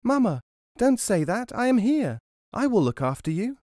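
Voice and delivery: put-on high-pitched little pea boy; tiny child calling. A narrator's reading. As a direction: call out to mother as a small child. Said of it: "the pea which had escaped called".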